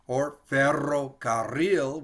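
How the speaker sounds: "ferrocarril" slow, rolling each rr; trilled R demo. Shown as a demonstration. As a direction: take "ferrocarril" slowly and trill it. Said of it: "We'll pronounce the R a couple times".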